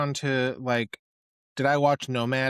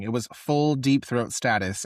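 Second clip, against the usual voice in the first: usual speaking voice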